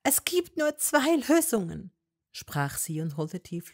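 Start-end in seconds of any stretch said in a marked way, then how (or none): 0.0-1.9 put-on higher young woman's voice, pleading and firm; Hermine voice. A narrator's reading. Hermine to Malfoy, higher and insistent. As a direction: argue with him, voice raised high.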